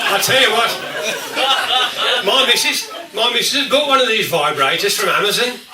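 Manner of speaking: words squeezed out through laughter, very slow at first; laughing while talking. Real, not acted. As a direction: fight the laugh to get the joke out. Laughing through the vibrator joke.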